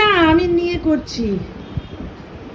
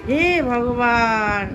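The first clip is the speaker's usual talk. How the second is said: long, drawn-out exasperated sigh of words; 6 semitones lower, much slower than usual. Real, not acted. Vlogger despairs at Ranu holding the paper upside down, exasperated. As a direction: drag the words out, lower, in exasperation.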